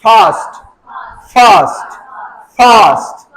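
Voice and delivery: फास्ट with air escaping on the F; airy English F. Shown as a demonstration. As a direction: say फास्ट without closing the lips on F. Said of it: "थोड़ी सी हवा बाहर आना चाहिए".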